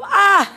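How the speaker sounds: a high, loud mock scream; imitated scream. Teasing the girl after her piercing, mock high scream. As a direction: squeal it like the girl did, teasing. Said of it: "Pero gritaste, dijo".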